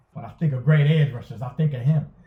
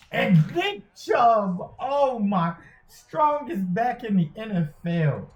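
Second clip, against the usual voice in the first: high, drawn-out commentator's cry; slow, raised-pitch play-by-play exclamation; excited play call; 6 semitones higher, slower than usual. Real, not acted. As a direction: stretch the call out high over a big play. Big play against him, live commentary.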